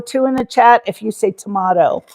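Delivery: tomato said as tuh-MAH-toe. Shown as a demonstration. Repeating the chat poll, tomato as tuh-MAH-toe. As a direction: say tomato with the ah vowel.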